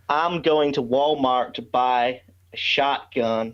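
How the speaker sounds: slow Southern accent with enunciated vowels; slow the pace and stretch the vowels, Southern American. Shown as a demonstration. Non-American guest shows hosts his impersonation, slow Southern American accent. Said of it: "okay, we enunciate the vowels".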